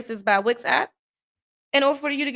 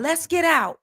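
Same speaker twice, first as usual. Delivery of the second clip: higher, eager retold suggestion; eager companion imitated; 7 semitones higher, slower than usual. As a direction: mimic someone keen to hop out. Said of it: "they talking about".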